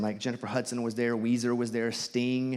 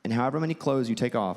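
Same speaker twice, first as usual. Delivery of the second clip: usual speaking voice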